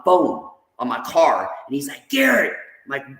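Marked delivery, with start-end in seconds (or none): 2.1-2.5 high, falling call of the name; retold interrupting call. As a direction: cut in on someone's rant, pitched up. Sponsor interrupting his yelling, retold.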